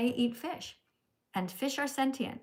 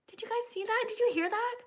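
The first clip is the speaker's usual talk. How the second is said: ordinary talk, nothing marked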